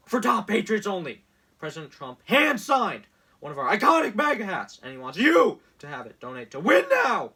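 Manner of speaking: loud, pushy sales-pitch voice; mock campaign ad announcer; hammed-up ad read. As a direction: sell it like a cheesy infomercial. Reading Trump campaign text aloud.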